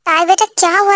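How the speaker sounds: ordinary delivery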